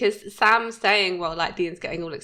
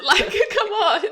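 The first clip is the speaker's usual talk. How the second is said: high, loud laughing protest; incredulous, pitched right up and laughing; laughing disbelief; 13 semitones higher, 7 dB louder than usual. Real, not acted. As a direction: exclaim it through a laugh. Laughing disbelief at lax set security.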